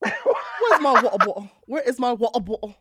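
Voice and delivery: hammed-up British with dropped t's; badly faked English accent, played for laughs; terrible English accent. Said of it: "in a terrible English accent".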